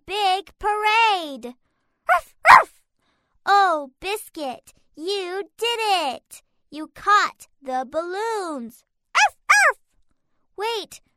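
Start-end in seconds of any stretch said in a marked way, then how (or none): 2.1-2.8 puppy barking. Acted. Puppy barks. 9.1-9.8 puppy barking. Acted. Puppy barks.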